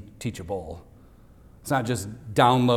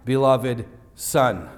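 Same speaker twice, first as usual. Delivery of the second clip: slow, weighty quote; much slower than usual. Shown as a demonstration. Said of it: "He affirmed the identity of Jesus".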